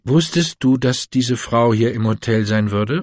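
usual speaking voice

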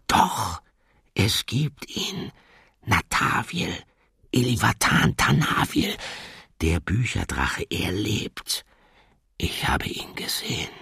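slow hushed confession; whisper. Acted. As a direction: whisper it like a dangerous secret. Revealing the secret in hush.

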